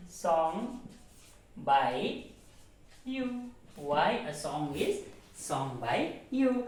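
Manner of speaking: teacher dictating with long pauses, then reading back slowly; dictating word by word to students; slow word-by-word dictation. Shown as a demonstration. Teacher dictating slowly to the class. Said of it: "dictating the passive question".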